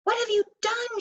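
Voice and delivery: high, sharp angry demand; mild mock angry shout. A narrator's reading. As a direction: scold the others. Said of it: "shouted Crooked Root angrily".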